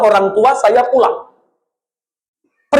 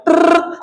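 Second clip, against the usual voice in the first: mimicked phone ring; 6 semitones higher than usual. Phone ringing imitated.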